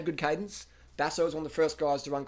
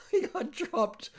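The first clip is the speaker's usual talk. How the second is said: laughing; much slower than usual. Real, not acted. Laughing at rider dropped.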